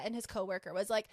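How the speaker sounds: ordinary talk, nothing marked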